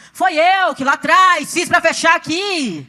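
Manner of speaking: slow, drawn-out calling out; acted-out cry of admission; retold confession. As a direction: proclaim it to the whole room, stretching the words. Retold public confession called out.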